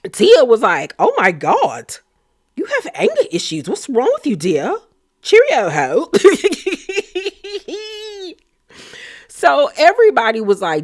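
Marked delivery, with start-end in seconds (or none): 1.0-6.1 mock British accent, swooping high; hammed-up British lady; Tia's put-on British accent. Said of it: "with that accent". 6.2-8.3 long high-pitched laugh; high giggle. Real, not acted. Cracks up at own impression.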